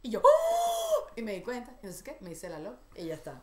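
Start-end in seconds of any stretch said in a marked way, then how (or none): 0.2-1.1 loud high "oh" of mock shock; grito de susto. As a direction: shriek as if caught out. Acts shock at seeing her reply. (chillido agudo de susto actuado)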